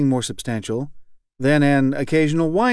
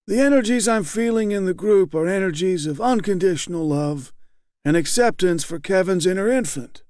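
high, blandly kind leader; 7 semitones higher than usual. A narrator's reading. Group leader gently affirms weeping Kevin, high and blandly kind. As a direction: soothe him like a patient therapist talking to a child. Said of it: "The round leader's high, hoarse voice had the same blandly kind, didactic quality".

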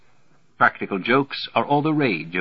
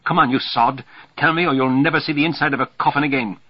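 raised, fast, hectoring threat; angry threat; 7 semitones higher, 5 dB louder than usual. A narrator's reading. Threatening someone with the coffin, angry. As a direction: threaten him loudly and quickly.

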